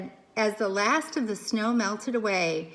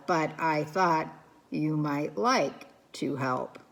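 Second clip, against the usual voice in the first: calm robot voice; 6 semitones lower, 3 dB quieter than usual. Acted. Robot Roz voice held.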